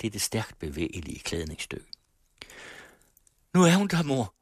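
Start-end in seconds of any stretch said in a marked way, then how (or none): 3.5-4.3 high, raised call. A narrator's reading. Daughter calling from the window to her mother, high and raised. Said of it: "råbte Emmeline fra vinduet".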